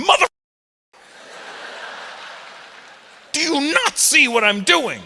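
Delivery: high, loud exasperated scolding; indignant woman. Retold or imitated. Five-year-old scolding dad like an annoyed woman. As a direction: snap it like a fed-up lady. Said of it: "my daughter basically went".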